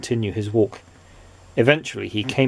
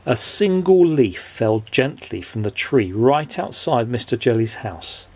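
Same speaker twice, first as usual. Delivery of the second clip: plain reading voice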